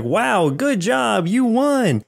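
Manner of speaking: high, slow, fake-cheery congratulations; mock cheerful praise. Judges congratulating the winner, mock cheerful. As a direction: gush like a clueless judge. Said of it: "they were just like".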